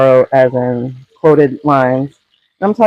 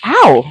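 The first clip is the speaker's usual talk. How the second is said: pained yelp; 6 semitones higher, 5 dB louder than usual. Real, not acted. Burned by spattering bacon.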